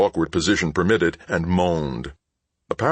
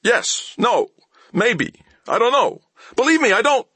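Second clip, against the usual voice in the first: high, louder panicked whine; whining; 4 dB louder than usual. A narrator's reading. Thief babbling answers to Mal, high whining. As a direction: babble in fear. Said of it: "Stop whining".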